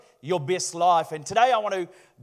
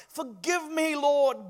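begging; 5 semitones higher, 4 dB quieter, slower than usual. Acted. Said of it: "begged Noah".

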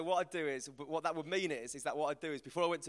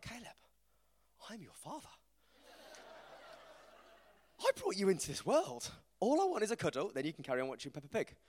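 half-hearted hammed-up pleading, a little higher; mock wounded father; 6 semitones higher than usual. Dad begging his son for a cuddle, mock wounded. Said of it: "And I'm like".

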